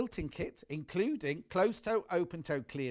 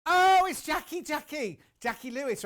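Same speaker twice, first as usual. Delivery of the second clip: much higher, louder burst of recognition; sudden remembering; 9 semitones higher, 8 dB louder than usual. Real, not acted. Host suddenly recalls the customer's name, excited. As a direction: it just came to you, blurt it out.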